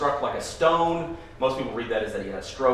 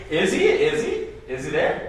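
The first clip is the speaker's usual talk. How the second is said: mocking sing-song; 4 semitones higher than usual. Mocking the Ziphites' rhetorical questions.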